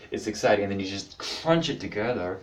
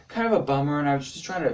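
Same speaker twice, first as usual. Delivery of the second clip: normal talking voice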